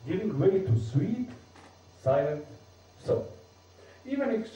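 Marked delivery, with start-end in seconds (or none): 0.8-3.3 "sweet, silent thought" slowly, each word stressed and set apart by pauses; slowed, stressed words. Shown as a demonstration. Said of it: "vocally we must slow down the words in order to hit the stress".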